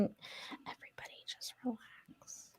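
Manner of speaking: slow soft whisper. Pretend. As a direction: whisper it slowly to calm everyone. Whispered calming joke.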